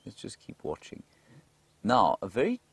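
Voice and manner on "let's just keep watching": whisper. Real, not acted. Quiet watching at wildlife sighting.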